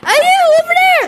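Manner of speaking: high, fast loud call; calling from far away. Real, not acted. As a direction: call across to someone far. Calling to another player.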